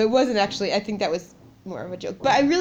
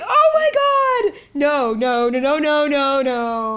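high shrieking oh my God, then loud repeated no; panicked disbelief, pitch shooting way up; retold freak-out at the twins news; shocked squeal; 6 semitones higher, 7 dB louder, much slower than usual. Shocked at forty percent twins.

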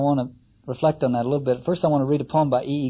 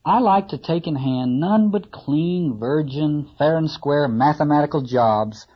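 fussy carpenter; 2 semitones higher, 3 dB louder than usual. Acted. Carpenter complaining about the cobbling job, sniffy. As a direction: grumble like a proud carpenter.

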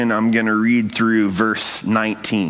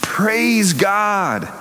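high, lifted cry of praise; healed man lifting a high cry of praise; shouting praise; 7 semitones higher than usual. A narrator's reading.

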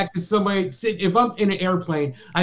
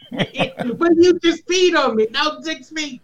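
laughing mock protest; 9 semitones higher, 3 dB louder, slower than usual. Laughing through an acted quarrel.

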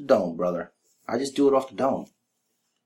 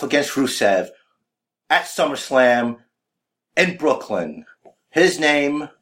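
announcer; 3 semitones higher, slower than usual. Acted. Interviewer introducing Rich to the crowd, announcer style.

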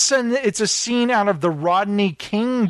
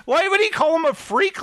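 very high-pitched disbelief; incredulous; 11 semitones higher than usual. Retold or imitated. Viewer reacting to the cop's odd insult, high incredulity. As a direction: squeak it out in baffled disbelief.

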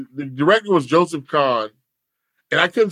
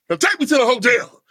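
demanding; 6 semitones higher than usual. Retold or imitated. Acted demand to the van driver.

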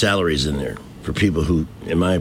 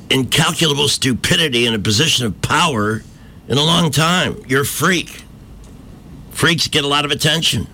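higher, scornful talking-down; mocking tirade; 7 semitones higher than usual. Real, not acted. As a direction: lay into her with contempt. Ranting directly at the congresswoman.

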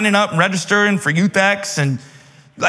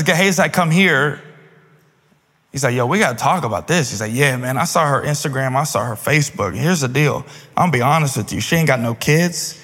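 casual Gehazi voice; 6 semitones lower than usual. Retold or imitated. Gehazi voiced in retold scene.

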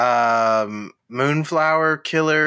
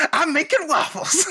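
high character voice; 12 semitones higher than usual. Retold or imitated. Quoting movie character.

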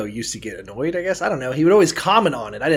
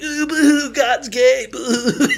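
high fake sobbing boo hoo; mock crying; 11 semitones higher, 3 dB louder, slower than usual. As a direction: whine like a crybaby, much higher. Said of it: "be like".

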